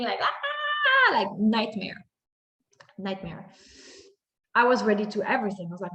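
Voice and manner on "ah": high, loud mock scream of a child in pain; imitated scream. Mother recalls her son at the hospital, high mock scream. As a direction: shriek like the boy under the hospital lights. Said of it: "him screaming".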